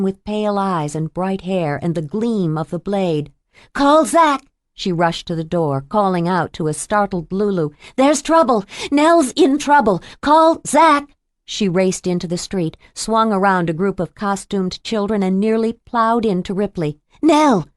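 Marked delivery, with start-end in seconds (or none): 3.7-4.4 high, loud alarmed call; loud high alarm cry; urgent shout. Acted. Mia urgently calling out. 8.0-11.1 high, loud alarmed yelling; building panicked shout for help; urgent shout. Acted. Mia shouting warning to Lulu. 17.2-17.7 high loud cry of the name; alarmed cry. Acted. Mia crying out on street.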